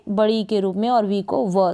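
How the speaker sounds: ordinary talk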